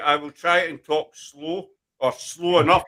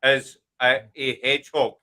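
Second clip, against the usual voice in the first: 1 slow spelled out; slower than usual. Shown as a demonstration. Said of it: "She said that".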